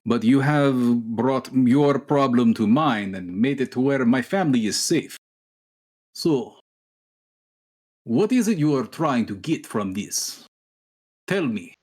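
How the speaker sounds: a rough, hammed-up Russian accent, slow in places; cheesy Russian accent. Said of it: "It's like a cheesy Russian accent".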